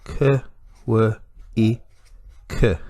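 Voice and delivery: K, W, I, K as short phonemes with long gaps; four separate sounds. Shown as a demonstration. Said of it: "four sounds".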